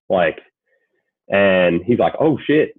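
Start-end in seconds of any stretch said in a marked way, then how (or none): 2.2-2.8 imitating a taken-aback reaction, pitched up; mild retold surprise, higher voice; surprised realisation. Dad spotting who just walked in, imitated surprise.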